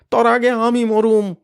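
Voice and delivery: high, loud desperate father cry; father's anguished shout. Acted. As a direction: throw yourself forward. Father crying out to protect son.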